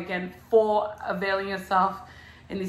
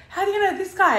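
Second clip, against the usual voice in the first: mock high question; 10 semitones higher than usual. Retold question to designer.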